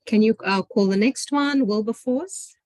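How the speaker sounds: normal talking voice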